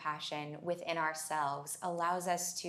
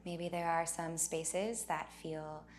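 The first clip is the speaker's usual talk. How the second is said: unhurried meditation leading, leaving space for breath; guided meditation. Acted. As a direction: guide them gently inward, phrase by phrase.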